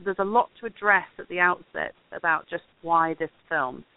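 ordinary delivery

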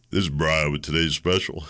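Domino's phone greeter. Retold or imitated. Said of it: "I really can do it".

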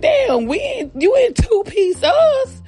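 very high, drawn-out disbelief; hammed-up high complaint; mock incredulous protest. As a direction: act out hurt surprise, voice way up. Acted protest to sister.

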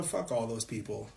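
ordinary talk, nothing marked

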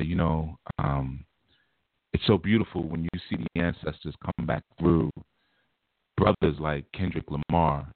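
low, measured, reverent speech; hushed, praise-seeking delivery. Shown as a demonstration. Host showing listeners how to get praise, in a low solemn delivery. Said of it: "bring the volume down just like this".

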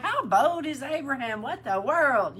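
high, loud incredulous outburst; acted astonishment. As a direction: react in mock disbelief at such boldness. Preacher acts own amazed reaction.

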